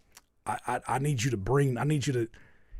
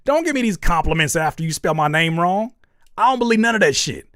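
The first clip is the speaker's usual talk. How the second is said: mock offended; 4 semitones higher, 6 dB louder than usual.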